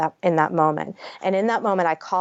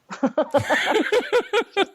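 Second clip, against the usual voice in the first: a burst of high real laughter; 12 semitones higher than usual. Real, not acted. Joking about staying on the drug, real high laugh. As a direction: laugh out at the irony.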